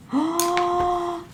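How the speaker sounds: high startled intake of breath; sudden shocked gasp, no words; sharp gasp. Real, not acted. Reacting to troubling card.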